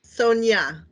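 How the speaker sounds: Sonia said SOHN-yah; "Sonia" as SOHN-yah, falling at the end; correct pronunciation. Shown as a demonstration. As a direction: show how "Sonia" goes, with a Y sound. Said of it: "is it pronounced Sonja?".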